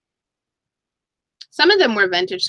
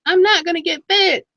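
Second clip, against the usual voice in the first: mock giving up; 9 semitones higher than usual. Acting out buyer giving up.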